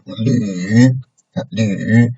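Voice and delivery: LÜ dipping low, then a little up; falling-rising LÜ; third tone. Shown as a demonstration. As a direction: push LÜ down low before lifting it slightly. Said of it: "you really low down your pitch".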